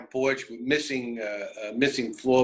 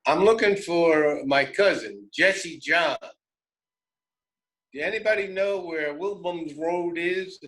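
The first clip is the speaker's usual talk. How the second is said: lightly voiced Southern asker; hillbilly cousin; 5 semitones higher than usual. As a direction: ask directions like a country cousin. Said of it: "he says".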